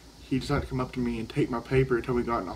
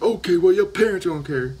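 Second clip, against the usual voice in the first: high, much louder mocking principal; mock principal warning; 7 semitones higher, 8 dB louder than usual. Principal warning the student about her parents, high and loud. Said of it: "She's like".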